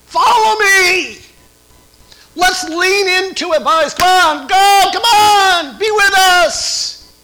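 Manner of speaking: a coach roaring his players on; rallying cry. Pretend. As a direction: call the team forward from the front. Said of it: "I wanna be leading from the front, say".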